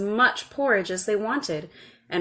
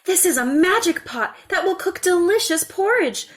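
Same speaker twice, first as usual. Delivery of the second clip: put-on high, bright-eyed child explaining; eager little girl; 11 semitones higher, 6 dB louder than usual. A narrator's reading. Little girl showing her mother the pot, high and eager. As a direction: show it off proudly. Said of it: "the little girl explained".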